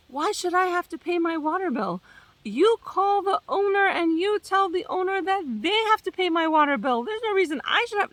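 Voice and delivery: high, indignant mock complaint; mad tenant. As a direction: whine it up high like an outraged tenant. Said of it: "She got so mad and she was like".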